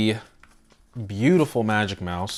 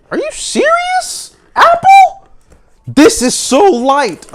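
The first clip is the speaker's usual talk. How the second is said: shocked; 13 dB louder, slower than usual. Real, not acted. Shocked by the light weight.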